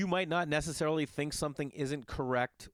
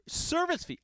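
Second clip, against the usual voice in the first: outraged disbelief. Acted. Outraged repeat of the fee.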